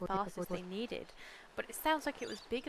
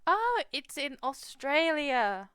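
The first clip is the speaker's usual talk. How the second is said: loud, slow, higher exclamation; delighted surprise; 5 semitones higher, 8 dB louder, slower than usual. Real, not acted. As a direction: draw out the happy realisation. Discovers where Kiama is.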